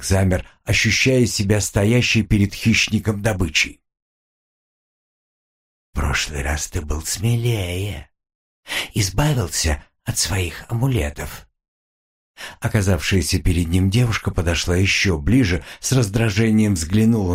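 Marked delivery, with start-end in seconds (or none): 5.9-11.5 low, hushed demon woman; a mocking demon, low and soft. Demon woman taunts him. (низкий голос насмешливого демона)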